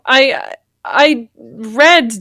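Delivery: usual speaking voice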